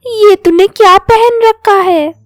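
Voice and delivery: high, loud teasing auntie voice; mocking neighbor aunty. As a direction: jeer at her like a nosy neighbor lady. Neighbor aunty mocks Manju.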